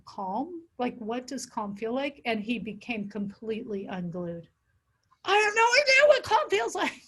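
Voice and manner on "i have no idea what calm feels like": high, loud frantic voice; panicked client. Retold or imitated. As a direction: voice the client coming unglued, frantic. Client quoted, came unglued.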